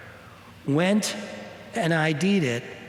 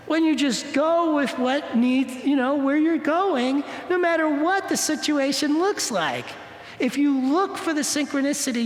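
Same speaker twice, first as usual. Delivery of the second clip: raised, earnest uplifting message; inspirational preaching; 7 semitones higher than usual. Acted. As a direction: lift the voice to teach the lesson. Sermon-like lesson on synchronicity.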